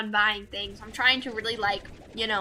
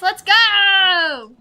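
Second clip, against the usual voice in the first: high, loud hype yell; drawn-out cheering shout; excited cheer; 7 semitones higher, 9 dB louder than usual. Real, not acted. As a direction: shout it like a celebration. Cheering for favorite slimes.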